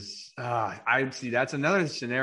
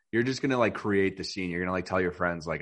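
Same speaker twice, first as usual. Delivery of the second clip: ordinary talk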